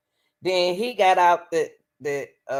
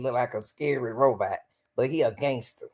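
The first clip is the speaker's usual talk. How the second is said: normal talking voice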